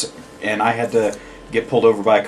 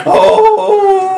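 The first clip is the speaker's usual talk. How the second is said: shocked squeal; 5 dB louder than usual. Acted. Mock shocked outburst.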